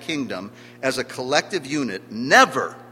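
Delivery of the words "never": "never" punched loud with a falling end; emphatic NEVER. Real, not acted. Preacher on the kingdom as a collective unit, "never" stressed loudly. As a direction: hit "never" hard as one word. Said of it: "I emphasize the word never".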